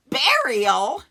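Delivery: loud, high cry of disbelief; shocked echo. Acted. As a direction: blurt the word out in alarm. Shocked echo of Jesus's words.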